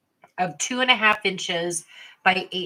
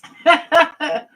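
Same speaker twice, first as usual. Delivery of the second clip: embarrassed laugh; 7 semitones higher, 9 dB louder than usual. Real, not acted. Choking on pretzel, laughs it off.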